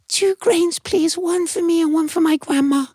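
very high tiny voice; a tiny ant politely refusing; squeaky modest no. Acted. Ant's request.

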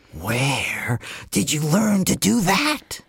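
slow, deliberate mother snake; drawn-out, menacing snake mother; stern mother snake. Acted. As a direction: warn your child, slowly and firmly. Mother Snake scolds her daughter.

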